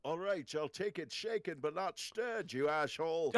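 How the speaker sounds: Arnold doing a bad Sean Connery; a Scottish Bond line through an Austrian accent; Sean Connery impression. Arnold showing off his Sean Connery impression. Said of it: "You wanna hear my Sean Connery?".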